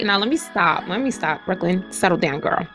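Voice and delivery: ordinary talk, nothing marked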